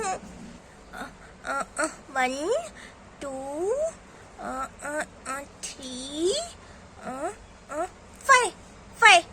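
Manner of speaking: put-on whimpering, halting childish counting; hesitant little girl. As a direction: grope nervously for the next number. Child struggles to remember count. (हिचकिचाती, रुआँसी बच्ची)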